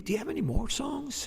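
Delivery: high, lightly voiced exec asking; a hint of an eager record man; label guy voice. Retelling the label guy's question.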